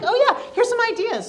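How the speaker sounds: high, loud, chirpy imitation; imitating eager intern. Speaker imitating her intern's eager reply, high and chirpy. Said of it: "she was like".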